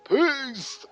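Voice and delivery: high-pitched, drawn-out farewell; high mock sign-off; mock farewell cry. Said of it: "he's like".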